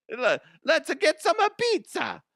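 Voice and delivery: very high, hammed-up cartoon Italian; silly high-pitched Italian accent; mock Italian accent. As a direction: ham it up as a squeaky Italian caricature. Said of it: "she's speaking with an Italian accent".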